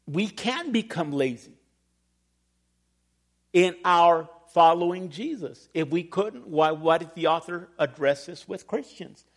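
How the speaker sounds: ordinary delivery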